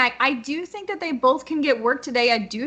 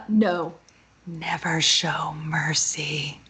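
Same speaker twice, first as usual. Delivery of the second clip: slow, low whisper; mock-menacing whisper; 7 semitones lower, much slower than usual. Answering whether to show mercy, slow menacing whisper. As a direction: whisper it slowly, like a ruthless vow.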